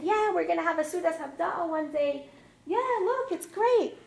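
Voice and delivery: high, loud, enthusiastic agreement; giddy retold celebration; over-bright cheer. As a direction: cheer them on in a lifted voice. Upbeat reply to daughter after crash.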